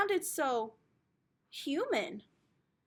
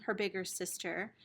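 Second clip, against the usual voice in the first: ordinary delivery, nothing marked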